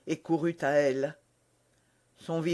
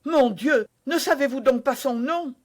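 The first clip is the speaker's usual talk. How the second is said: alarmed; 7 semitones higher, 5 dB louder than usual. Acted. D'Artagnan urgent.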